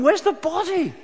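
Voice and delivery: high, loud frantic question; desperate cry. Acted. Mary at the empty tomb, crying out desperately. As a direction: search in panic.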